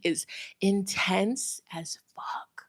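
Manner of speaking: slow, breathy whisper. Real, not acted. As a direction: lean in and whisper it like a secret. Hushed aside about intense energy.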